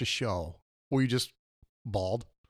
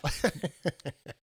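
fake laugh; 3 semitones higher, 3 dB quieter than usual. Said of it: "There's that insert fake laugh".

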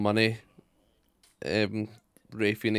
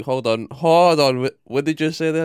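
mock interruption; 4 semitones higher, 7 dB louder than usual. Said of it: "and then, like".

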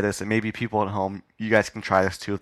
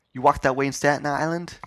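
higher-pitched mock New Yorker; New York accent; 6 semitones higher than usual. Pedestrian challenging whoever bumped him, New York accent. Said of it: "say in the New York accent".

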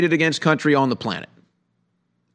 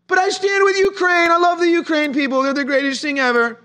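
much higher, sing-song mocking falsetto; mocking Ukraine supporter; 11 semitones higher than usual. Commentator mocking pro-Ukraine crowd, high falsetto sneer. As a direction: mimic a gushing fan in a high, silly voice. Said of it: "It's like".

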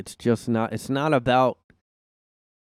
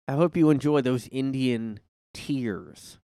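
sing-song taunting, rising loud; gloating mockery; 4 semitones higher, 6 dB louder than usual. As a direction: gloat over it with mock relish. Mocking gloating over Thanksgiving.

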